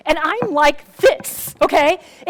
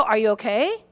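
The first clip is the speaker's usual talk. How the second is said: hushed concern at a neighbor's door; soft, careful question; 7 semitones lower, 6 dB quieter than usual. Retold or imitated. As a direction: lower and softer, gently checking. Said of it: "You might go".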